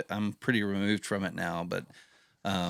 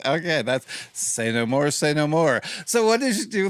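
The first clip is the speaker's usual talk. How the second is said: laughing through; 6 semitones higher, 5 dB louder than usual. Real, not acted. Laughing, moving on.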